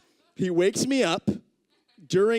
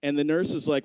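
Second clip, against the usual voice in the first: normal talking voice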